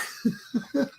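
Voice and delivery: high, soft wheezy laugh. Real, not acted. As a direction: laugh at your own punchline. Laughs at pa's reaction.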